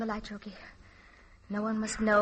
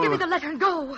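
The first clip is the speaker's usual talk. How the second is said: higher, louder urgent command; urgent demand; 5 semitones higher, 5 dB louder than usual. Acted. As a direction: push him out firmly.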